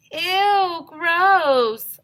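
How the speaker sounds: high, loud grossed-out squeal; high, loud revolted shriek; disgusted kid. Acted. Boy disgusted at teacher.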